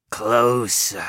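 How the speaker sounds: hissing ghoul voice, breathy; breathy hiss through teeth. Acted. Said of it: "the ghoul hissed".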